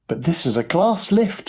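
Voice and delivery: high, worried protest; alarmed shout. A narrator's reading. Grandpa Joe fearing a crash, alarmed shout. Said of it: "shouted Grandpa Joe".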